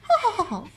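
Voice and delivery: high, dainty witch cackle; a restrained little witch laugh; polite witch cackle. Pretend. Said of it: "This is my polite Wicked Witch laugh".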